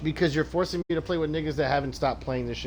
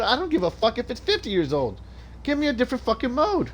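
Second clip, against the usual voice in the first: raised pitch, heated demand; furious gamer outburst; angry rant; 10 semitones higher than usual. Real, not acted. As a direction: yell it at the game in real frustration. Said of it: "I'm gonna put that on a shirt".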